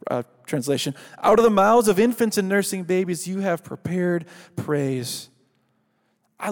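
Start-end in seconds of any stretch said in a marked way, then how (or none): 1.2-5.3 loud then slowing scripture quote; preaching. Acted. Preacher reading Jesus's scripture reply to the congregation.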